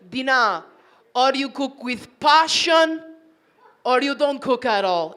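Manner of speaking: imitating an Italian husband, emphatic; Italian accent. Husband scolding wife's cooking in the kitchen, Italian accent. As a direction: put on his Italian accent, drawing out the words. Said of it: "he says it in the accent".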